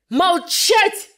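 резко выше и громче, грозно; оборви ученицу окриком; грозный окрик. Acted. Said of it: "прервал Жюли грозным голосом учитель". (грозным голосом: молчать)

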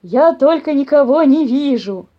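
loud, slow bear voice; growling bear. A narrator's reading. Papa bear unable to see the mice, growling. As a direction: growl loudly, baffled. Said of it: "прорычал папаша Бамсе".

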